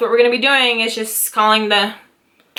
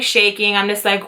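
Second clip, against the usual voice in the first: usual speaking voice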